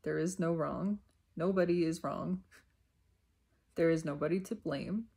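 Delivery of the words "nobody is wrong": normal talking voice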